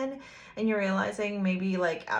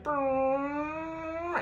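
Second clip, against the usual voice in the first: engine brrr; 7 semitones higher than usual. Acted. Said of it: "So he's gonna be like".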